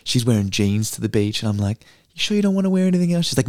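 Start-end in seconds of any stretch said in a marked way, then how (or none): 2.1-3.2 high, fast mock-doubtful question; skeptical brother. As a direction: ask it high and quick, eyebrows raised. Big brother questioning sister's jeans.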